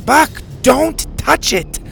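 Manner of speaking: high, slow urgent call. A narrator's reading. Bo calls Buck away from the frog, urgently. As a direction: call each word out to the pet. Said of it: "Bo called".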